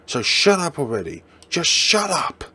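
high, loud shout to shut him up; screaming. Acted. Boyfriend screaming at girlfriend to shut up. Quarrel peaks.

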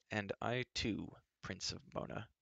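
muttered. A narrator's reading. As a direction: mutter it under your breath, vexed. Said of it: "Taran muttered".

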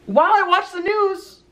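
high-pitched bunny telling its story; rabbit storybook voice. Acted. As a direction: read as a little bunny to a child. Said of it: "What kind of voice do rabbits have".

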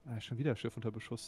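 normal talking voice

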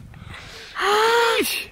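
sharp high gasp; shocked gasp. Real, not acted. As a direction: gasp at the sight of blood. Sees the bloody finger.

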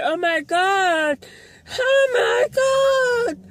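very high, drawn-out gleeful impression; mock delighted squeal. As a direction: mimic her overjoyed squealing. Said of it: "She's so happy as well".

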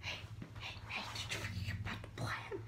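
fast hushed whisper. Real, not acted. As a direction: whisper urgently. Secret plan off camera.

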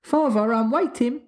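put-on high little-boy voice, mildly cold; small child's cool impatience; coldly. A narrator's reading. Small son waiting on his father, mildly coldly. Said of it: "said Michael coldly".

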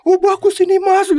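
high, loud, pleading junior. Retold or imitated. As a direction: raise the voice into a tearful junior's plea. Junior voiced, eyes tearing. (meniru suara junior yang tinggi dan memelas)

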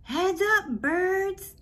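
high, loud yell to the birds; screamed warning. A narrator's reading. Boy screams a warning at the pigeons, loud and high. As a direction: shout it out to scatter them. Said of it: "I screamed".

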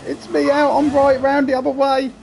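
high, loud panicked cry as the kart spins; shrieking in real panic after spinning off the track. Real, not acted. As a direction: yelp in real alarm at crashing. Spins off onto the grass.

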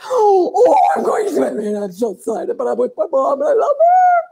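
a gasp, then squeaky, jumpy excitement; a puppy bursting with joy beside its mom; thrilled dog voice. Pretend. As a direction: owner voicing her dog heading to the swimming hole. Dog voiced walking to the swim.

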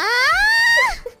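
high shrieking laugh; laughing hard after the slang impression; loud burst of laughter. Real, not acted.

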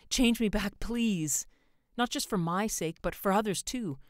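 high, loud, desperate plea; pleading. A narrator's reading. Man begging the stranger for everyone's sake, pleading loudly. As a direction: beg with full urgency. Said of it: "George pleaded".

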